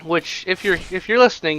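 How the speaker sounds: ordinary talk, nothing marked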